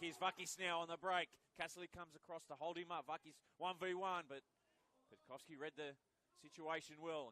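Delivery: loud, high racing call of the counterattack; excited play-by-play. Acted. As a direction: ride the break like a live caller. Live call of a break.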